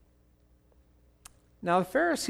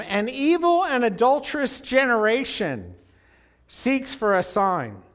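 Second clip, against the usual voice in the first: Gertie's preaching voice; 7 semitones higher, 7 dB louder than usual. Retold or imitated. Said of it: "I think I've heard you preach this, Gertie. Your voice sticks in my head here".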